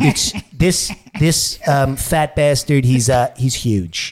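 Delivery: slow presidential impression; Donald Trump. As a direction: speak with a drawn-out politician's bombast. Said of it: "I got Trump and Fat Bastard".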